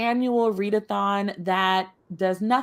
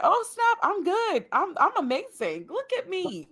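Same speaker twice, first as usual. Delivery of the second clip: excited bragging; 8 semitones higher, 3 dB quieter than usual. Retold or imitated. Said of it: "I was like".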